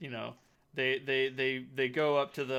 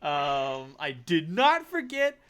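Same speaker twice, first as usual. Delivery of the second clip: high, loud enraged rant; furious accusation; 8 semitones higher, 7 dB louder than usual. Acted. Duelist raging at the rat who stole his blood, high and loud. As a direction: spit out the grudge at the rival.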